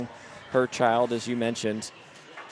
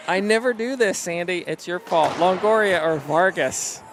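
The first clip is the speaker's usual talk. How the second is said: trying not to laugh; 6 semitones higher, 4 dB louder than usual. Real, not acted. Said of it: "You're infectious".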